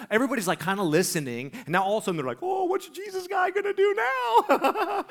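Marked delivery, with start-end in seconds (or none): 2.4-5.0 high, slow put-on crowd voice; mocking onlookers. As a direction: imitate gawkers in a high, drawn-out voice. Voices the onlooking skaters in unison.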